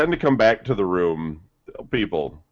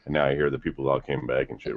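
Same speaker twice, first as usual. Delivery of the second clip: normal talking voice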